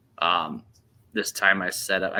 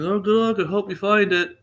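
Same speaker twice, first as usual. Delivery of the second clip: high, louder quoted reply; acted cheerful well-wishing; 12 semitones higher, 5 dB louder than usual. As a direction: wish him luck in a raised, upbeat voice. Acting other hunter's cheerful reply.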